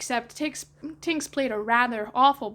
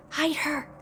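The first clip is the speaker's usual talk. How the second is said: usual speaking voice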